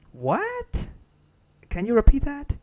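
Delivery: high, baffled "what?"; confused American guy. Retold or imitated. American guy can't understand the Burmese speakers, high baffled question. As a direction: play the puzzled American who can't follow.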